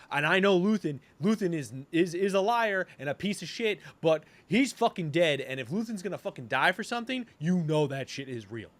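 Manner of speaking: imitating a furious rebel; heated rant. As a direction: insist on it like he's fed up. Acting Cassian's angry speech.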